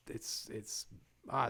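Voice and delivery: ordinary talk, nothing marked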